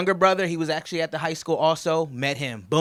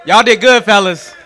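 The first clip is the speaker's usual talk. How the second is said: loud, raised congratulation to the room; hearty shout-out; 5 semitones higher, 15 dB louder than usual. Real, not acted. Best man to the fellas, loud hearty praise. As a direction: call it out over the cheers.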